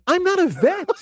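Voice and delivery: high, squeaky-pitched comic protest; exasperated protest. Retold or imitated. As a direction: act out being wrongly dragged into it. Retells his exasperated protest to bystanders.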